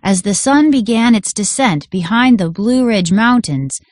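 usual speaking voice

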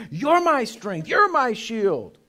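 high, loud triumphant declaration; soaring, loud confession of faith; shouted praise. Shown as a demonstration. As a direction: belt it out to God in victory. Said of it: "He wants you to be saying".

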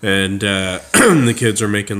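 ordinary delivery

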